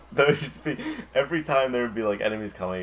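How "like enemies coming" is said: ordinary talk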